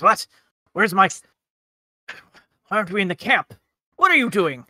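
mildly indignant waking outburst; Otis, not happy. Acted. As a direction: demand answers, grumpy and disoriented. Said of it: "oh, he is not happy".

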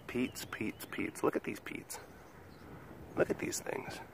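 breathless whisper. Real, not acted. Owner whispering about the guinea pig's droppings, excited whisper. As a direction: hush it like a secret.

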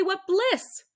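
ordinary delivery

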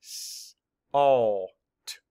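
S-, all, t each said as a separate sound, S- breathed without voice; segmented sounds; sounds mapped apart. Shown as a demonstration. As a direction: tap out S-, all, t one sound at a time. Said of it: "let's map the sounds".

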